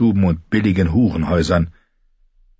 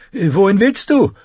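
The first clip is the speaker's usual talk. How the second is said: put-on much higher girl's call; Arya calling; 13 semitones higher, 3 dB louder than usual. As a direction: call after the running boy. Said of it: "rief sie". (hoher Mädchenruf)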